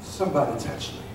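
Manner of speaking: low, very soft murmur; hushed quote. Acted. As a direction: drop right down, almost to yourself. Hushed quote of Jesus' response.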